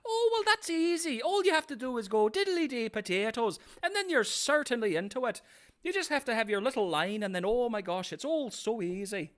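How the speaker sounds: very high, playful stage Irish; Irish accent. Shown as a demonstration. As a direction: show off a hammed-up Irish accent. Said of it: "You do an amazing Irish accent".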